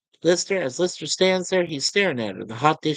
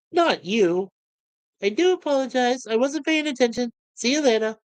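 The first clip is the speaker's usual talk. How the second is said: high, polite talking-toilet voice; a high, prim, over-polite machine; prissy toilet voice; 8 semitones higher than usual. Retold or imitated. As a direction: play the fussy toilet that apologises. Toilet apologises as it revolves.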